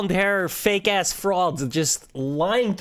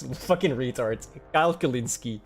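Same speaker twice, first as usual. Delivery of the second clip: ordinary talk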